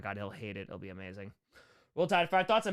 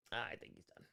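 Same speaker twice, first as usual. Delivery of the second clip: whispered, unvoiced "I think he's done"; 6 semitones lower, 17 dB quieter than usual. Real, not acted. Streamer whispering a quiet aside about a retired player. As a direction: breathe it out as an aside. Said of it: "repeat whispered".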